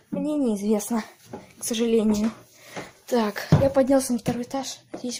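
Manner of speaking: ordinary talk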